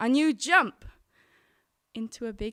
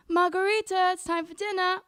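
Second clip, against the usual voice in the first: mother's high, raised dinner call; shouting upstairs; 7 semitones higher, 6 dB louder than usual. Retold or imitated. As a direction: call up the stairs to the table. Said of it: "used to shout up the stairs".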